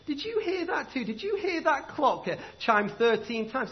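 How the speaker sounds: a small man's high puzzled question; little man. Acted. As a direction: voice the little stranger, high and curious. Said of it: "He said".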